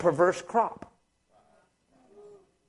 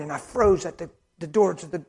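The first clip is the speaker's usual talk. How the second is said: ordinary delivery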